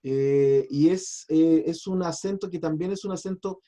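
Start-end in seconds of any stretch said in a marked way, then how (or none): none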